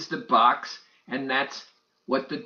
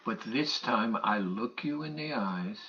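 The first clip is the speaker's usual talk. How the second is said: normal talking voice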